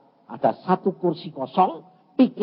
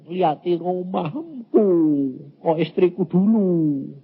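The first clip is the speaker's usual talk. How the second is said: retold complaint; 2 semitones higher, slower than usual. Acts out complaining to God.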